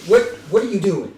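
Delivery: demanding shout. Acted. Sailor confronts stowaway kid.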